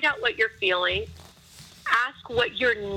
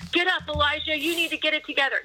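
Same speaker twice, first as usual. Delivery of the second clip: higher-pitched mock rebuke; mock scolding; 5 semitones higher, faster than usual. Imagining God ordering Elijah up, mock scolding. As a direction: scold briskly, playing a stern command.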